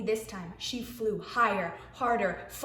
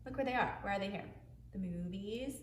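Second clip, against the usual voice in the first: plain reading voice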